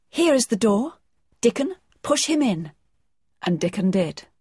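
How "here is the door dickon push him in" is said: excited urging. A narrator's reading. Mary urges Dickon excitedly.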